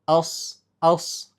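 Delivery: ordinary talk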